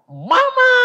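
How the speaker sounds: very high, loud cry for mother; child's shout. Retold or imitated. Revived child calls mother, high imitated shout. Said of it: "Anaknya teriak".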